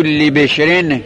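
لبشرن begins with "le"; slow "le-beshren" for لبشرن; kasra on lam. Shown as a demonstration. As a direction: say لبشرن with a short e after the l. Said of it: "لام جر کسر مکسوره روش میره".

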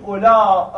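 long final ā closed by a glottal stop in اولا. Shown as a demonstration. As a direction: stretch the end of اولا, then cut it with a hamza. («اولا» با الف کشیده و همزهٔ پایانی)

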